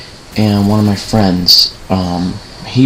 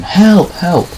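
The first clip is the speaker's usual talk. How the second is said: high, mildly raised mock scream; imitated yell for help, pitched way up; retold cry for help; 11 semitones higher, 4 dB louder than usual. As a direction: call out as if reenacting a scream for help. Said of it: "so I'm screaming, I'm screaming".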